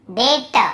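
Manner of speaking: "डेट" as det with released T; silent B. Shown as a demonstration. Teacher repeats debt for students, "डेट" with B silent. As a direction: sound out "डेट" with no B before T. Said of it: "केवल डेट".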